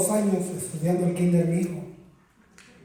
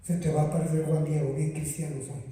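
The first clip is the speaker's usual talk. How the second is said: quick mock threat; 4 semitones lower, 6 dB quieter, faster than usual.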